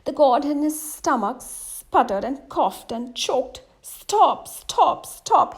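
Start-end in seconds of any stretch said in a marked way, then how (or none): 3.8-5.5 high, loud choking cries; crying out. Acted. God choking on hookah smoke, loud high cries to stop. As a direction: cry out as the smoke chokes you. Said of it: "he cried".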